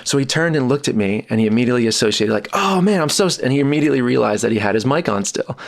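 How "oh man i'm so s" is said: startled apology. Retold or imitated.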